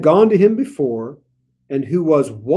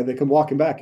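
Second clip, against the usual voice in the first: usual speaking voice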